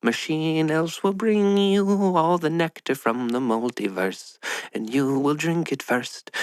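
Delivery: slow, high Irish lilt; a lilting Irish machine elf, slow and high; Irish accent. Pretend. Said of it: "I don't know why they're Irish".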